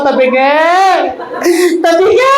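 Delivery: hammed-up sobbing cry of the name; mock crying out over the coffin; mock wailing widow. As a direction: wail it like a grieving wife, half in jest. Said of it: "Iyak nang iyak".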